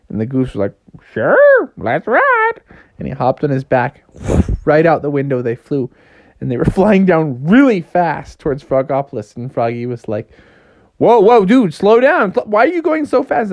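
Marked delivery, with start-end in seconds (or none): 1.1-2.6 high, loud bird voice; parrot-like goose. Acted. Goose offers Froggy a ride, squawking. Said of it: "Why do you talk like a parrot?". 11.0-13.5 high, loud mock alarm. Froggy riding a too-fast goose, alarmed.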